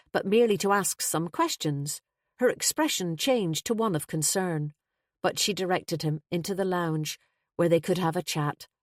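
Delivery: ordinary delivery, nothing marked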